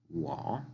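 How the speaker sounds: "wo" dipping, then rising; third tone. Shown as a demonstration. Teacher giving Chinese word for yo, dipping-rising tone. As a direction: let "wo" sink and climb back up. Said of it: "en tercer tono".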